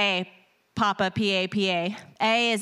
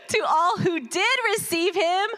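full-force preaching; 6 semitones higher than usual. Acted. Preacher to church, lifting the verse: fervent preaching. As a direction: proclaim it over the music.

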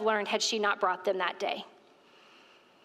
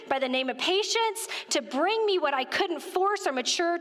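fervent preaching; 6 semitones higher than usual. Real, not acted. Preaching to the church about patience, fervent. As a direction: press the point home to the congregation.

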